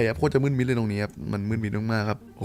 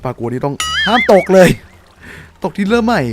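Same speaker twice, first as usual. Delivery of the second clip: louder, higher tense warning; tense yelling; 8 semitones higher, 8 dB louder than usual. Real, not acted. Gamer warning himself not to fall, tense loud voice. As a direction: call it out with nerves.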